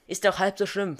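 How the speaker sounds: put-on girl trying to calm the raging librarian; placating. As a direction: talk her down from her rage. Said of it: "versuchte ich sie zu beschwichtigen".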